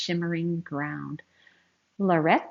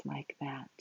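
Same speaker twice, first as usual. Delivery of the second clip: whisper; 4 semitones lower, 14 dB quieter than usual. Real, not acted. Teacher whispering a warning to young listeners. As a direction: lean in and breathe it. Whispered aside about lions.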